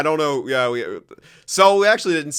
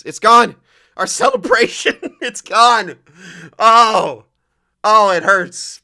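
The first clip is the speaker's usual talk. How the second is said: higher, louder mock anguish; 9 semitones higher, 11 dB louder, slower than usual. Real, not acted. Gamer laments losing his celebration footage, loud anguish. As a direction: wail over the lost clip.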